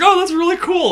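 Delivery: high, faked interest; fake enthusiasm. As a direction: pretend you care, brightly. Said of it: "just totally faking it".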